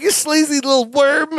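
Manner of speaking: high, sneering insult; a hammed-up tough-guy jab, pitched high; mock bully voice, high-pitched; mock angry [name]. [name] insulting Rob Bob.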